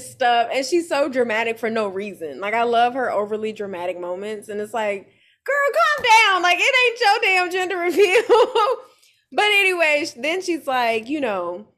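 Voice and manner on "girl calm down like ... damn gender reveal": high, loud laughing scold. Real, not acted. Telling the dramatic friend to calm down, high and laughing.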